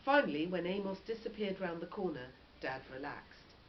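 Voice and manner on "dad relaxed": ordinary delivery, nothing marked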